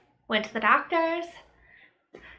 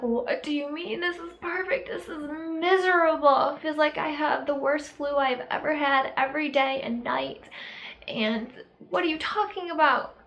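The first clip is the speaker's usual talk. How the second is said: incredulous retort; 5 semitones higher than usual. Retold or imitated. Retorting to people calling sickness perfect.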